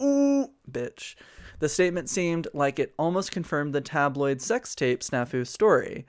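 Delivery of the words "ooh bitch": high, sassy ooh bitch. Real, not acted. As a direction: camp gasp of shade. Reacting to a catty quote.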